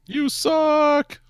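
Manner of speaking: very high, hammed-up shriek; high-pitched pained student yell; screamed insult. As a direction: wail it at the teacher in agony. Screaming student yells at teacher.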